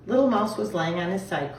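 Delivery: ordinary delivery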